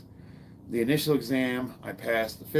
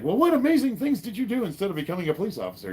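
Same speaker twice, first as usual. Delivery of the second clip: higher, fast mock curious questioner; imagined listener asking; 9 semitones higher, 4 dB louder, faster than usual. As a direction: voice a sceptical audience member. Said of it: "you might ask".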